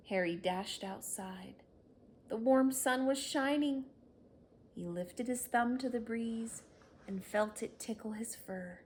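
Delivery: ordinary delivery, nothing marked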